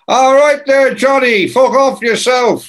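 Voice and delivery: loud, high-pitched rock singer impression; boisterous put-on voice. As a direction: imitate a singer hailing someone with a cheery brush-off. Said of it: "And [name] goes".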